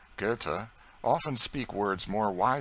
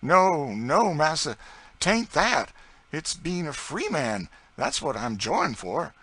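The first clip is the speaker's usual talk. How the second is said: much higher, louder servant voice, full of joy; Tom's joyful voice; 9 semitones higher, 8 dB louder, slower than usual. Acted. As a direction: cry out the joy of being free. Tom joyful at freedom.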